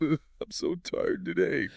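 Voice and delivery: slow, high, drawn-out tired sigh of a line. Shown as a demonstration. Friend slumping down, complaining in a tired voice. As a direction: sound worn out, dragging each word. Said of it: "Tired voice".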